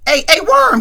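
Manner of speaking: loud, high shout after a leaving truck; calling out. Retold or imitated. Craig calling after Big Worm's truck, shouting. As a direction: yell to stop the truck.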